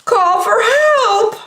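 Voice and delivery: high, slow frightened wail; calling for rescue; hammed-up cartoon panic; panicked cry. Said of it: "cried Bebe".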